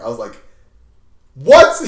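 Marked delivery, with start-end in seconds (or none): 1.3-1.8 loud high shocked yelp; shocked what. Retold or imitated. Student hearing of detention, shocked yelp. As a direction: blurt it out in disbelief.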